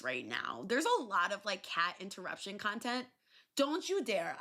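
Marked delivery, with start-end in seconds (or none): 3.5-4.4 high, firm warning to the cat; mock-stern don't you dare. Real, not acted. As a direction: stop the cat in its tracks.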